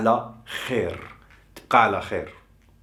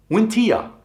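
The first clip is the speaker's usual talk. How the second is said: وانتيا said much higher, falling at the end; high-pitched demo; 11 semitones higher, 3 dB louder, faster than usual. Shown as a demonstration. Teacher shows how to ask and you, in a high voice. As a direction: lift وانتيا up high, then let it drop. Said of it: "Or وانتيا".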